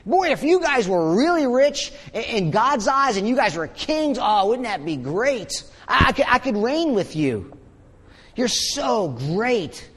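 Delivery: mock-wishful, with swooping pitch; sarcastic. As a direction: gush with pretend admiration. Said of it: "He says sarcastically".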